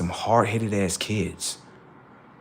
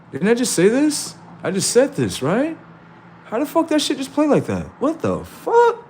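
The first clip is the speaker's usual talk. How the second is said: very high, incredulous squeal; high-pitched astonished questioning; amazed disbelief; 13 semitones higher than usual. Acted. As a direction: react as if you can't believe your ears. Acts out amazement at coincidence.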